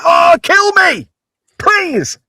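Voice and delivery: high, drawn-out wail of exaggerated agony; mock despair. Man hearing his soulmate's accent, mock-despairing wail. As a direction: beg hammily to be put out of misery.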